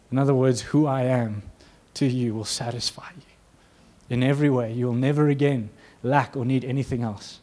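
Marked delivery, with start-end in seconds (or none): none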